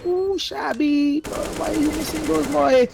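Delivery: high, drawn-out cry of dismay; anguished excitement. Real, not acted. Caster cries out at Shabby missing shots, high anguished wail. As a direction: wail it high at a botched play.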